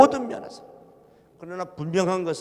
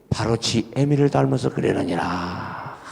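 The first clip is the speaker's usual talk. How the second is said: lower, slow, solemn divine pronouncement; voice of God; 6 semitones lower, slower than usual. Retold or imitated. God answering the pastor's prayer, lower and slow with solemn weight. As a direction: speak as God handing down a verdict, slowly and low. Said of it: "그랬더니 하나님이 저를 위로해 주시더라".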